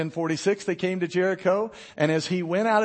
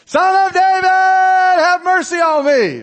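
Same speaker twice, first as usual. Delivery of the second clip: full shout, much higher and louder, dragged out slowly; louder desperate cry; 12 semitones higher, 13 dB louder, slower than usual. Acted. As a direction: scream it over everyone, desperate. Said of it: "he got louder".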